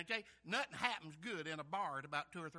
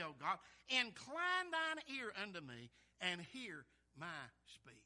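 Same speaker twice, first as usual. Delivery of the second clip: slow, solemn Bible reading; scripture reading; 5 dB louder, slower than usual. Acted. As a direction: give each phrase of the verse room. Reads scripture aloud.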